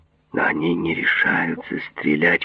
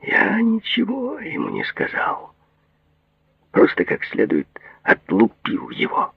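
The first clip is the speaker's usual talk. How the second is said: self-satisfied. A narrator's reading. Patient boasts of beating the servant, self-satisfied. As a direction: savour it with smug satisfaction. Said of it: "Бурк удовлетворённо улыбнулся".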